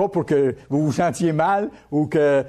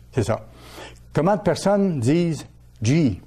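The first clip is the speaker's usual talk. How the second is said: ordinary talk, nothing marked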